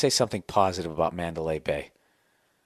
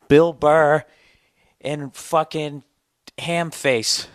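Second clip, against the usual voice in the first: announcer voice; 7 semitones higher, 7 dB louder, much slower than usual. Acted. Vegas billing announcement.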